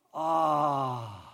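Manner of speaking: low, contented ahh as he falls back; a smiling, refreshed exhale; satisfied sigh. Retold or imitated. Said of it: "he says with this satisfied smile".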